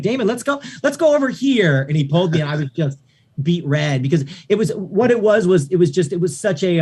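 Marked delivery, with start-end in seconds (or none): 0.0-1.8 urgent partner. Retold or imitated. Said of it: "my production partner at the time was like".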